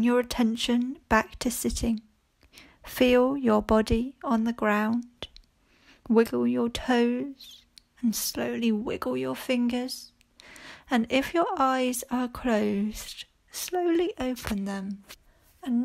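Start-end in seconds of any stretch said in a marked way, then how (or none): none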